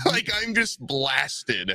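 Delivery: normal talking voice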